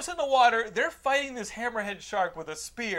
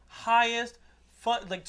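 ordinary delivery

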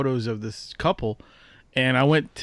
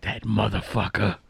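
low mock mutter of "That motherfucker."; muttered curse; 6 semitones lower, 5 dB quieter than usual. Imitating a New Yorker cursing after you, low mutter. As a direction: growl it under your breath, imitating them. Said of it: "they're like".

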